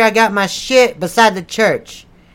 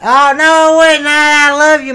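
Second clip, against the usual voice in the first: higher, urgent backpedaling; flustered alarm; 4 semitones higher, 5 dB louder than usual. Acted. Preacher scrambles during wife's call, flustered. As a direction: blurt it out in alarm.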